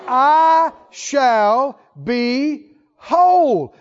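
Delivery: very slow, loud, each word weighed; slow declaration. Acted. Preacher voicing the woman's faith, slow and emphatic. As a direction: declare it slowly, with full conviction.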